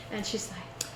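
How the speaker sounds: ordinary talk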